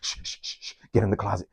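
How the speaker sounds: urgent whispered shh, then hushed order; whispered shushing. Retold or imitated. Friend hiding someone in the closet, urgent whisper. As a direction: hiss it so nobody outside hears.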